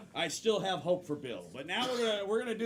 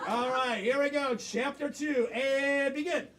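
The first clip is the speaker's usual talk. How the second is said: loud, raised host call; announcer kickoff; 5 semitones higher, 5 dB louder, slower than usual. Real, not acted. Host starting chapter two for the performers, announcer kickoff. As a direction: launch the round with a big, lifted voice.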